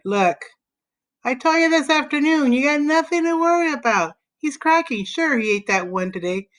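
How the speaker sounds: put-on high-pitched boy's voice, put-upon; exasperated boy Joe. A narrator's reading. Joe reassuring worried Alan on the phone, exasperated.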